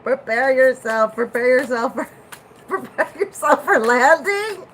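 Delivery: mock cabin announcement, giggling; flight attendant voice. Mock pilot announcement, laughing.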